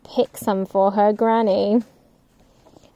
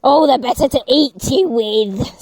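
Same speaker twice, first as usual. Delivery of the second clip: wolf snarl; 3 semitones higher, 3 dB louder than usual. Acted. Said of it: "snarled Granny".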